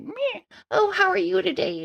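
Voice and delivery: high, soft spa-staff voice; fancy spa worker. Retold or imitated. Spa worker greeting a client at a fancy place, mock politeness. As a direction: imitate a dainty, high, quiet greeter.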